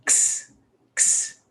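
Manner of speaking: isolated "x" said as a crisp ks sound; just the consonants k and s for "x". Shown as a demonstration. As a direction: sound out the letter "x" as ks, twice. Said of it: "the pronunciation of the X is".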